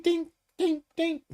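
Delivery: squeaky high ding, like a bell; bell-like high ding sound. Retold or imitated. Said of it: "y'all will know why I said ding".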